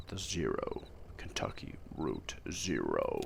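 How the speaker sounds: low, slow, hushed route announcement; ominous reveal. Acted. Naming the mysterious route to a driver, ominous reveal. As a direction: drop low and draw out the name.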